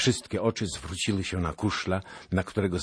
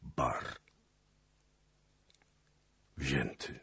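grim hushed report; 3 semitones lower, 7 dB quieter, slower than usual. A narrator's reading. Officer reporting disaster.

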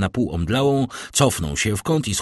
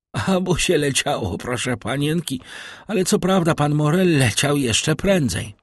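higher, put-on voice of a puffing old servant; old servant Barrois; 7 semitones higher than usual. A narrator's reading. Old servant Barrois answers the young mistress, puffing and deferential. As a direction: play the breathless, deferential old valet.

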